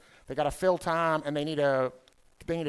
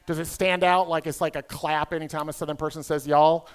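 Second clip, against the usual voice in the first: normal talking voice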